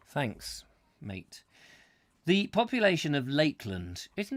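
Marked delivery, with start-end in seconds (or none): none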